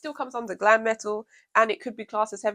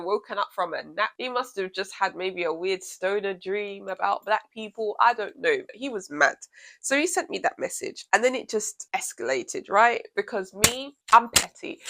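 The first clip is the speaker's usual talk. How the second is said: usual speaking voice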